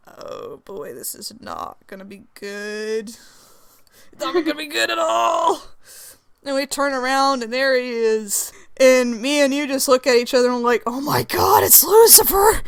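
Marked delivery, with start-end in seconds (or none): 0.1-3.1 very soft, low mumble; hushed dread. Acted. Player reacts to the furious newcomer, hushed dread. 4.1-5.6 higher, strained mock wail; mock panicked whine. Player dreading the angry newcomer, mock panicked whine. 10.9-12.6 rising to a high, loud cry; hammed-up horrified recognition; mock alarm. Two friends turn around and see Lucifer, mock alarm.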